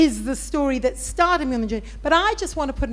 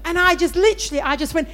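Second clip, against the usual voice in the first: usual speaking voice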